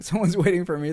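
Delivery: said through a laugh. Real, not acted. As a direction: laugh out the words. Laughs while saying goodbye.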